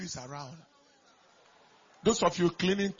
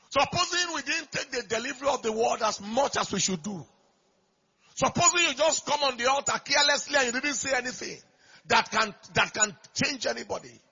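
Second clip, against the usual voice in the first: loud ringing sermon cadence, climbing high; big fervent pulpit delivery; preaching at full force; 4 semitones higher, 5 dB louder than usual. Acted. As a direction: preach it out to the whole church. Pastor exhorting the congregation.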